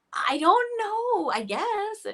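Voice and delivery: high, slow, hesitant shrug; drawn-out doubtful musing; unsure. Retold or imitated. Guest voices her doubt about singing abroad. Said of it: "where you're thinking".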